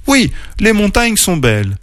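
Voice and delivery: high-pitched, mildly determined declaration. A narrator's reading. Oreille agrees to climb, mildly determined. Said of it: "déclara Oreille déterminée".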